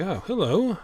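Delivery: lightly acted surprised hello; voiced game character. Game master as Graven noticing a wave, lightly voiced greeting. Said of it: "yeah, he's like".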